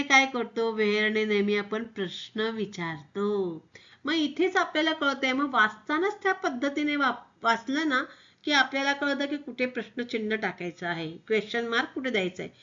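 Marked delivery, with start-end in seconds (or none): none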